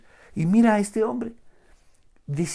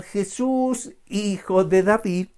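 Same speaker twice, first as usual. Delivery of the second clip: raised shouting cry to Jesus; 5 semitones higher, 4 dB louder, slower than usual. Acted. Blind beggar shouting out to Jesus for mercy. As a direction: call out loud over the crowd. Preacher acts blind man crying out.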